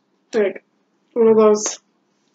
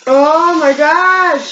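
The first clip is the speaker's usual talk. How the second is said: excited; 5 semitones higher, 4 dB louder, much slower than usual. Real, not acted. Spicy noodle hits, mom walks by.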